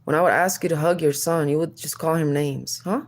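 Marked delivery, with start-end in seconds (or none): none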